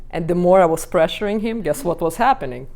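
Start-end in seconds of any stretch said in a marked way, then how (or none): none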